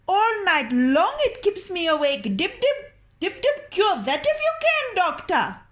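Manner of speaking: high, loud crone complaint; a high crone grumbling about the drip; complaining old woman. Acted. The old woman challenges the doctor to cure the tap, high.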